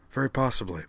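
sincere, understated agreement; without irony. A narrator's reading. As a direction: answer quite seriously. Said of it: "replied without a trace of irony".

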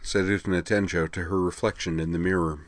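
ordinary delivery, nothing marked

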